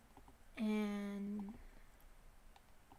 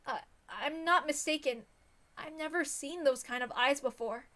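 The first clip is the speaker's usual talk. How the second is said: put-on much higher, gentle worried girl voice; worried girl Rena; 4 semitones higher than usual. Rena checking on Keiichi after he collapsed, worried.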